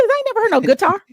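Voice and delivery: fast, high, loud objection; retold affronted outburst; indignant protest. Retold protest at changed intro.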